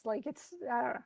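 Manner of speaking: usual speaking voice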